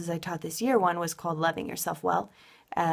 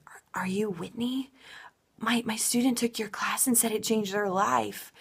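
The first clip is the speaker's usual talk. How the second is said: higher, halting awed question; amazed professor; 4 semitones higher than usual. Retold or imitated. Professors coming up to me about their student, mildly amazed. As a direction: lightly imitate an impressed professor.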